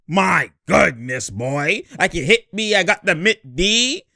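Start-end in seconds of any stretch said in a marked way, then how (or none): none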